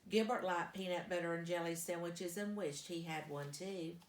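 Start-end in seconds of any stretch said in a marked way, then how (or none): none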